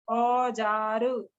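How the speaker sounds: औजार stretched very slowly; slow demo. Shown as a demonstration. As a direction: drag out औजार so they hear the vowel. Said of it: "ओ का sound सुनाई दे रहा है". (औजार को बहुत धीरे खींचकर)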